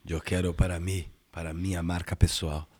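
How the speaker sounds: a strange man asks for the pose, low and slow; low slow character voice. Acted.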